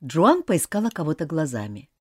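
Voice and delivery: usual speaking voice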